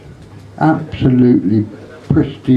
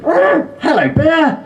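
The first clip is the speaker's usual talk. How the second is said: squawky parrot; slower than usual. Acted. Parrot greeting new owner in high squawk. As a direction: squeal it out like a brothel parrot.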